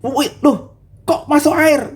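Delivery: high, alarmed double take; startled disciples. Retold or imitated. Disciples watching Peter step into water, startled. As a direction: blurt out in alarm. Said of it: "Mereka pasti bilang".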